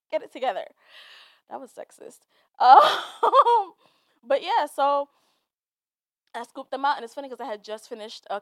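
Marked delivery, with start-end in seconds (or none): 1.5-3.7 whispered line, then a high loud sob-laugh; teary laugh. Real, not acted. Vlogger scolding herself for crying, laughing through tears. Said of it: "I really just started crying".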